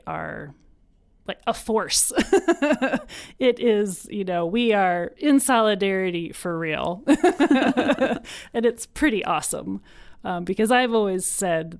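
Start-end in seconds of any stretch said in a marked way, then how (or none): 2.2-3.3 a bright burst of real laughter; delighted laugh. Real, not acted. Guest proud of her community, laughing with delight. 7.1-9.8 slow words through real laughter, louder; laughing. Real, not acted. Guest praising community support, laughing slowly. Proud of group solidarity.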